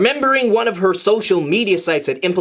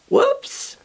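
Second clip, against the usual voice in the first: much higher, sheepish whoops; squeaky whoops. Acted. Ruby realizing it's night, squeaky whoops. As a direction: squeak it out caught. Ruby caught neglecting homework.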